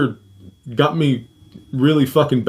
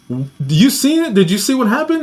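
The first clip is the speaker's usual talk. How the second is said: urgent questioning; 8 semitones higher than usual. Retold or imitated. Guard questions the snack lady.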